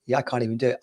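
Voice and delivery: ordinary talk, nothing marked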